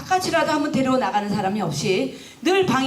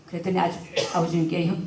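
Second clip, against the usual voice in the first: ordinary talk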